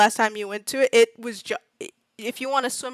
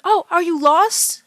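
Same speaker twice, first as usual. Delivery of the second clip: higher, slow, sugary concern; concerned local; 6 semitones higher, 5 dB louder, slower than usual. Acted. Polite local to lost strangers, slow sweet concern. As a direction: ask sweetly like a helpful neighbor. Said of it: "being like".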